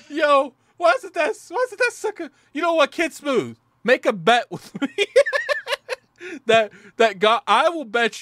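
loud, high hyped-up challenge; excited shouting. Real, not acted. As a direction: call him out, all fired up. Hyped challenge bet to cohost.

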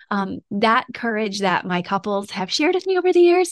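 usual speaking voice

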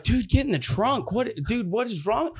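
mock-exasperated questioning; exasperated brother. Brother ordering him into the trunk.

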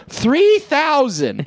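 very high, long-stretched call; mock bidding cry. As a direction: sing out an absurd bid, stretching it. Heckler yells a higher bid.

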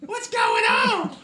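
very high, slow, squeaky put-on voice; groundskeeper Jim voice. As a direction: squeak it out as a silly character. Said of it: "sounds an awful lot like Michelle".

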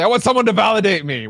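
quick mocking quote of "validate me"; mock sudden plea. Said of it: "come out of nowhere and say".

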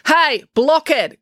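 high, loud taunting call. A narrator's reading. April hailing her brother from behind, calling out. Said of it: "called April".

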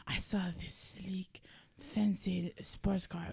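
breathless whisper. Acted. Woman whispers about first seeing her car husband. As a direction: whisper it in awe.

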